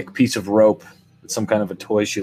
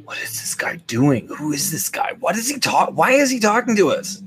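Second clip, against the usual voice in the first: muttering onlookers; 7 semitones higher than usual. Retold or imitated. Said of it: "People like".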